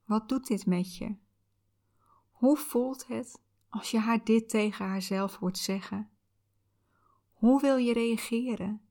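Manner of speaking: calm soothing visualization guide; soft spa whisper. Acted.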